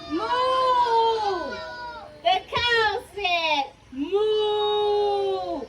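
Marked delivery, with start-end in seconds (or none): 0.1-1.6 long higher moo; playful mooing cow. Acted. 3.9-5.6 long shouted cow moo; a cow mooing loudly. Acted. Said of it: "so loudly".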